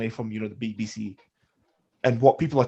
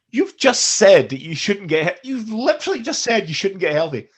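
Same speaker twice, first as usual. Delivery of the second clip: indignant retort; 7 semitones higher, 5 dB louder than usual. Retold or imitated. Arguing back at the government spokeswoman, indignant. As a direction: throw their words back at them, incredulous. Indignant imagined reply to Psaki.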